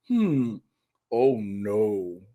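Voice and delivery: understated, dawning oh no; not-bad oh no. Comedian reliving tasting fudge, understated pleased dismay. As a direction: let it slip out as a savouring realisation. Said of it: "it wasn't a bad oh no".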